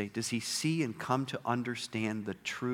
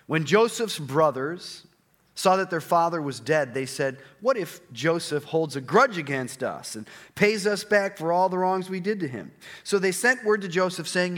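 raised, carrying reading voice; scripture reading; 6 semitones higher, 6 dB louder than usual. Acted. Pastor reading Genesis to the congregation, raised and carrying. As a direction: read the passage aloud to the congregation.

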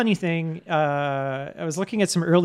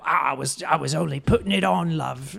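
mock British reveal; British accent. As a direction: lightly put on a British accent, chummy "love". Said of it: "like, British at the end".

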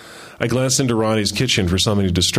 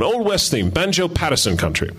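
put-on light Australian accent, pitched higher; a hint of Ronnie's Australian accent; 6 semitones higher than usual. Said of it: "that's my Australian accent".

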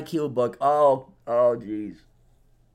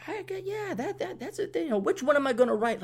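much higher, soft musing with a laugh; musing self-quote; 10 semitones higher, 6 dB quieter than usual. Retold or imitated. Retelling his motorcycle-ride thoughts, high soft musing. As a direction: voice his own giddy thinking.

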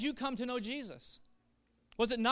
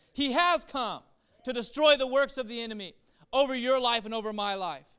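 normal talking voice

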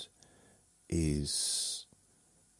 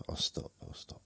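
each repeat softer until whispered; fading to a whisper; 8 dB quieter, faster than usual. Real, not acted. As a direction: trail off into a whisper.

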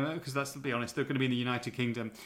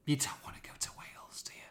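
conspiratorial whispered jibe; hushed cheeky dig at Wales; whispered aside; 11 dB quieter, faster than usual. Real, not acted. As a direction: lean in and whisper it like a secret.

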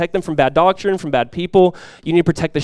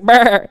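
loud imitated bleat, pitched up; sheep bleat; 7 semitones higher, 6 dB louder than usual. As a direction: bleat back like a sheep in the pile. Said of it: "you hear this, like".

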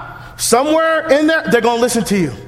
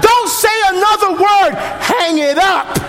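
urgent command; 6 semitones higher than usual. Real, not acted.

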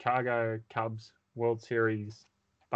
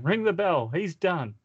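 high, loud finish call; excited call; 7 semitones higher, 6 dB louder than usual. Acted. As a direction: announce the win with a shout.

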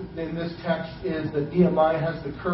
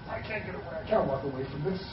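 quiet, soft mock plea; stuck and helpless; 11 dB quieter, faster than usual. Voicing someone who can't leave a relationship, quiet and helpless.

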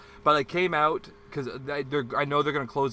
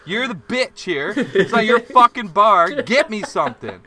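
much higher, much louder tirade; retold furious rant; 9 semitones higher, 10 dB louder than usual. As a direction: rage at him across the counter.

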